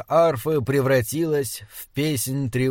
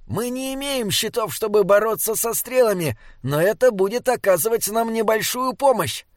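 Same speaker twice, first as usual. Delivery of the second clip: much higher, put-on character voice; small companion Binnebik; 8 semitones higher than usual. A narrator's reading. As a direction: explain briskly in a high-pitched voice.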